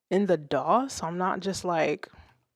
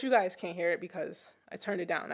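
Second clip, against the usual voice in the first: normal talking voice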